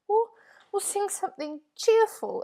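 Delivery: high, loud shaky quaver. A narrator's reading. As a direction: voice trembling with fear. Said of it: "in a quavering voice".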